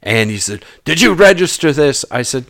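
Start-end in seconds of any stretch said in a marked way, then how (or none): 0.8-2.0 sharp questioning, pitched high and louder; a suspicious inspector pressing the owner; suspicious official. Retold or imitated. Searching officer demands of the boat owner, sharp and high.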